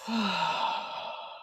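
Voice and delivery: long audible exhale through the mouth; sigh out. Shown as a demonstration. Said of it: "sigh out through the mouth".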